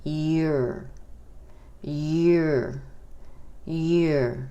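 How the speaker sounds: "your" said like "yore", one syllable; pronounce you're as your. Shown as a demonstration. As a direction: repeat "your" slowly and clearly. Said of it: "la contracción se pronuncia como".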